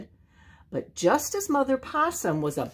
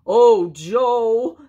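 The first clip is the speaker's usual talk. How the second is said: loud, higher exclamation; howling; 4 semitones higher, 8 dB louder than usual. A narrator's reading. Laughing bear calling to Joe, howled. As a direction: howl the name out. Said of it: "the bear howled".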